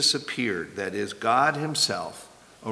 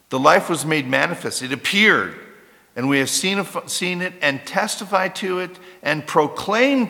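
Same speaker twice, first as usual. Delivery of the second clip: fervent preaching; 3 semitones higher than usual. Acted. Second preaching climax.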